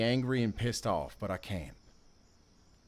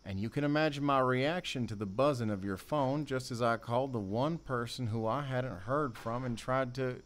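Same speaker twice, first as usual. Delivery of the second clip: normal talking voice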